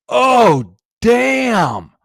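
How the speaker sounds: high, loud mock kid amazement; kid's amazed cuss. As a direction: play the wide-eyed kid blurting damn. Kid reacts to huge spider.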